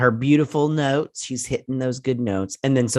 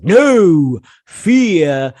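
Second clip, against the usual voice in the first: much louder, cut in hard on no; forceful interruption; 4 semitones higher, 11 dB louder than usual. Retold or imitated. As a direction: blurt the correction over her. Picard cutting in firmly.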